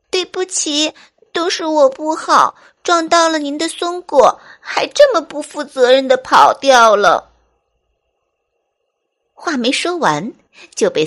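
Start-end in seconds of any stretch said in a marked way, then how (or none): 0.1-7.3 high little-fox voice, building louder; timid, tearful apology. Acted. Little fox to squirrel mother, high tearful confession. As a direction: apologise like a small, contrite child, pitched up. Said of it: "眼里噙着泪水，怯懦地说".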